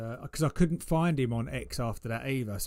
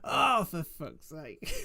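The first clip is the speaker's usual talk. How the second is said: slow, drawn-out, higher mock outburst; mock exasperated swearing; 5 semitones higher, much slower than usual. Streamer imitating a trader angry at price action, mock exasperation. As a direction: imitate his angry cursing, amused. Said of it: "He gets so angry with".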